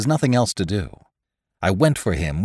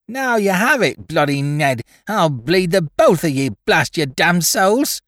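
put-on much higher, slow drunken shrill; piercing sharp voice; 8 semitones higher than usual. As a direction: shriek it slurred like a drunk boy. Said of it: "he shrilled at us in maudlin tones".

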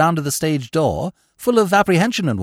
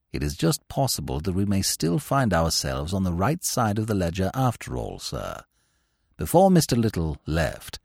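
lower, softer, measured valet; unflappable valet; 10 semitones lower, 6 dB quieter than usual. Acted. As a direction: keep it calm and deferential. Jeeves answers Bertie.

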